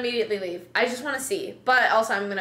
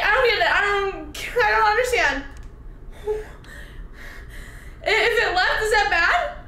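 high, loud whiny panic; panicked frustration; 9 semitones higher, 4 dB louder than usual. Real, not acted. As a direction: cry out in frustration.

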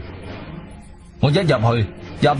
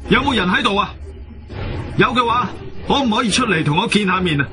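raised pitch, composed calling-out; composed loud call; 6 semitones higher than usual. Acted. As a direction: call out high but steady. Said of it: "我聲音都好鎮定咁大聲講".

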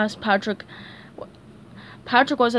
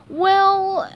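much higher, voice breaking nervously; squeaky nervous crack; 10 semitones higher, 5 dB louder than usual. Acted. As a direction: let the voice crack on the lie. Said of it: "his voice cracking a little".

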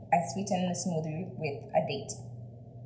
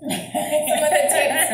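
laughing; 8 semitones higher, 8 dB louder, slower than usual. Real, not acted. Laughs after mixing ships/tips.

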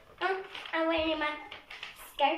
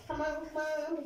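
softer, under-breath come on; quiet urging; 7 dB quieter than usual. Real, not acted. Girl rushing a cleanup, quiet under-breath urging. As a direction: mutter it to yourself.

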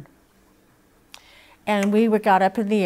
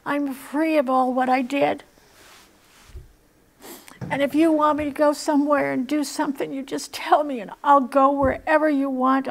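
high, trembling plea to God; tearful prayer; 6 semitones higher than usual. Retold or imitated. A hint of sobbing as she prays to God on the floor. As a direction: pour it out tearfully. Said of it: "And I was sobbing".

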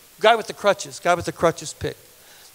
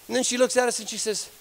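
ordinary talk

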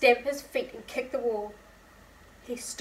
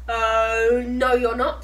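louder, bossy no; loud refusal; 10 dB louder, slower than usual. Acted. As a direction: bark it at the door. George refusing entry.

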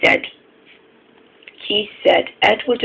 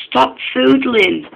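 cross protest; 7 semitones higher, 4 dB louder, slower than usual. A narrator's reading. Acted quarrel between engines.

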